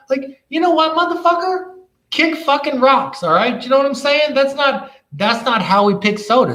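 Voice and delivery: high-pitched, fired-up tirade; heated rant. Real, not acted. Vlogger telling the imagined Walmart clerk off, high and fired up. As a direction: go up high and tell him off.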